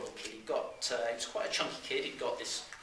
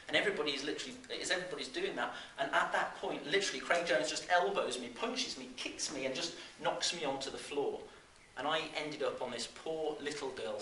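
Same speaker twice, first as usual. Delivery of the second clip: ordinary delivery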